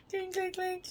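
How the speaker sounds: high, playful clink clink; mouthed clink sounds. Pretend. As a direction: say clink like the teeth tapping. Clinking fake teeth together.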